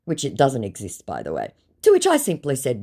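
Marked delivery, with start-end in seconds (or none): none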